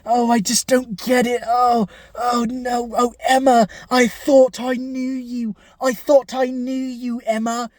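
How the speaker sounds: hammed-up heartbroken wailing, a mock cry on oh no; mock anguished lament. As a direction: whine it like a betrayed, crushed boyfriend. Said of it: "He's just like".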